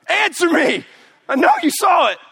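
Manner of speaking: loud, high mock yell at the phone; yelling at someone who won't reply; frustrated shout. Waiting on text reply.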